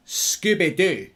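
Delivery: drawn-out mock Scottish accent; Scottish-accented catchphrase, stretched out. Shown as a demonstration. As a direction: say it slowly with a put-on Scottish accent. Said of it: "a Scottish accent".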